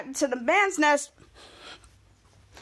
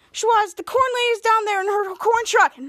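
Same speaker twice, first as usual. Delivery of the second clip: higher, keen excited telling; higher eager announcement; 8 semitones higher, 3 dB louder than usual. Acted. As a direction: rush it out excitedly. Kid pleading excitedly.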